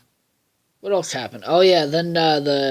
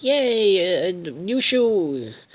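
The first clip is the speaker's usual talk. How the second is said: high, slow squeal of delight; excited kid; 6 semitones higher, much slower than usual. Retold or imitated. Milo shows off his new shoes, squealing high and slow. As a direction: stretch "new shoes" out, thrilled. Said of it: "He's like".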